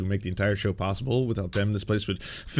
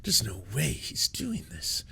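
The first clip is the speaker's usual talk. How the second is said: breathless whispered amazement; hushed awe; 7 dB quieter than usual. Pretend. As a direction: whisper it like a dramatic movie moment. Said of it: "everyone's like".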